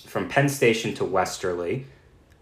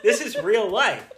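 laughing; 11 semitones higher than usual. Real, not acted. Laughing aside continues.

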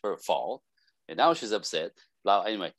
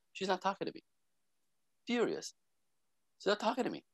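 baffled friend; 9 semitones higher, 8 dB quieter, faster than usual. Retold or imitated. Frustrated friend quoted after dinner.

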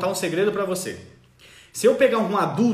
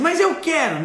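put-on much higher, louder kid voice pleading; a child protesting; whiny child; 10 semitones higher, 6 dB louder, slower than usual. Child refusing to stop playing, whiny high voice. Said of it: "Aí ele diz".

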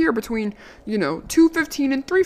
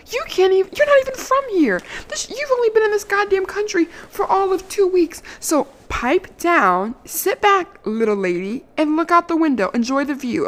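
high-pitched mocking tirade, much higher; sassy retold rant; 7 semitones higher than usual. Scolding the man in her own car, sassy high rant. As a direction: talk down to him shrilly as a joke.

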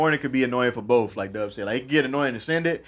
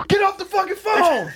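angry yell. Retold or imitated. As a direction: shout from the next room. Parent yelling in the background.

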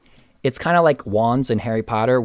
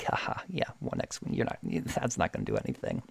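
hushed, much softer breathy sneer; scoffing whisper; 6 semitones lower, 12 dB quieter than usual. Retold or imitated. Moff Gideon scoffing at the lone X-Wing, hushed sneer. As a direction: laugh off the threat under your breath.

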